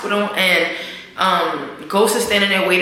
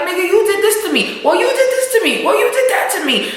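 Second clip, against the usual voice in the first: heated argument; 9 semitones higher than usual. Retold or imitated. Voicing a heated fight.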